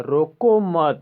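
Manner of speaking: loud, slow रोको मत as one command; मत joined to रोको. Shown as a demonstration. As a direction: run रोको straight into a strong मत. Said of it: "दूसरे number बोला जा रहा है".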